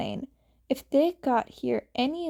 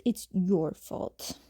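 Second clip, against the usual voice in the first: spat out; 4 semitones lower than usual. A narrator's reading. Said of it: "he spat out".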